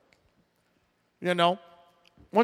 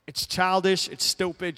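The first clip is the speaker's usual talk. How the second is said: ordinary delivery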